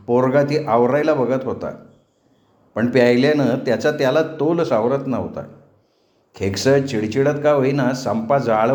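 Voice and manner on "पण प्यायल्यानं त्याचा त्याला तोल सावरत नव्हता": ordinary delivery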